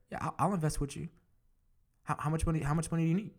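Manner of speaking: eager offer. Retold or imitated. Eager investor friend quoted.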